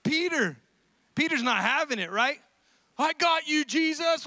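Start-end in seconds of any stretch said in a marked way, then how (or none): 3.0-4.2 high-pitched, eager heroic call; gung-ho defender. Acted. Peter rushing to defend Jesus, gung-ho. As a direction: jump in boldly to the rescue.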